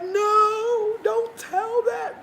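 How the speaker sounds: high-pitched, drawn-out mock plea; hammed-up high protest; protesting viewers. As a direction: wail it way up high, imitating upset viewers. Imagined viewers protesting her honesty.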